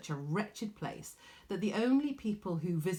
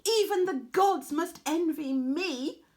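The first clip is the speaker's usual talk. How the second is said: preen over every word like a spoiled diva; arrogant boast; 11 semitones higher, 7 dB louder than usual. Acted. Arachne boasting to her mother, high and vain. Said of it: "she continued".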